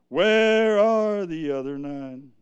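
slow, drawn-out call. Acted. Preacher voicing Jesus seeking the missing nine, drawn-out call. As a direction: call it out long, as if searching.

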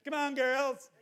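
high-pitched mock aerobics coach; TV fitness guy. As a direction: cheer like a TV workout host. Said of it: "reminds me of a guy who used to be on T V".

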